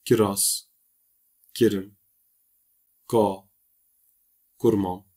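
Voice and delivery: "kiraz, kirim, ka, kurmam" with a soft K; soft K opening "kiraz", "kirim", "ka", "kurmam". Shown as a demonstration. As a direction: read each word with the soft Kurdish K, spaced apart. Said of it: "yumuşak okunuşa örnek kelimeler".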